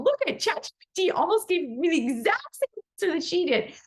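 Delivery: excited. Retold or imitated. Excitedly telling Kathleen about it.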